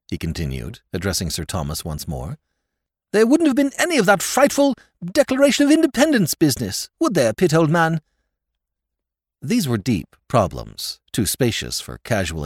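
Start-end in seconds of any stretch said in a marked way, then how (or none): 3.1-8.0 put-on much higher, louder, aggrieved young lord; self-pitying lord. A narrator's reading. Lord Drever lamenting to Sir Thomas, self-pitying. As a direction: wail it in a fervour of self-pity.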